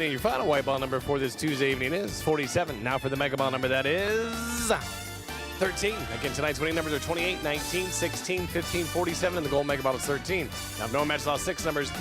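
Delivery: ordinary talk, nothing marked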